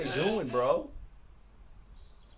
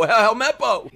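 ordinary talk, nothing marked

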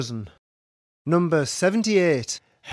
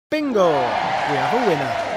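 shouted bingo call; 4 dB louder than usual. Acted. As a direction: belt out the win call. Calling a winning bingo.

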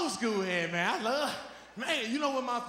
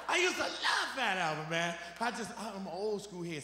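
usual speaking voice

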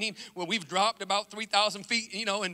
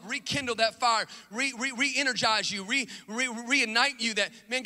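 usual speaking voice

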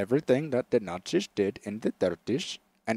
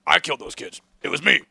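mock Alex Jones; 4 dB louder than usual. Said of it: "Alex Jones being like".

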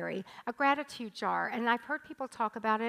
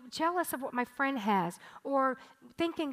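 normal talking voice

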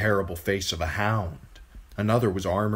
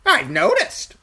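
high, loud eerie crow voice; 9 dB louder than usual. A narrator's reading. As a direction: caw it out like an uncanny bird. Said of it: "said the three-eyed crow".

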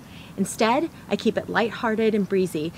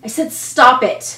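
sharp, louder order to stop; angry demand; 5 semitones higher, 8 dB louder, slower than usual. Acted. Parent losing patience with a child, louder angry order. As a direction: snap the demand at a defiant child. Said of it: "contrasting statements".

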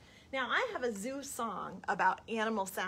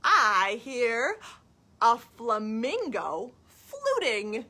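high, loud sing-song animal call; reading to children; 4 semitones higher, 6 dB louder, slower than usual. Acted. Storytime question and answer about a hippo, lilting and dramatic. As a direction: chant the question brightly upward, then stretch the answer. Reads picture book to children.

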